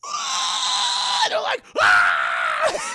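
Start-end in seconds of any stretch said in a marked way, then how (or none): 0.1-1.2 very high, loud sustained shriek; imitated piercing scream; mock scream. Old woman turns on the twins, with an imitated shriek. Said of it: "You would scream too". 1.8-2.9 loud, held answering yell; imitated scream of terror; mock scream. The twins react to the old woman, with a loud mock scream. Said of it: "You would scream too".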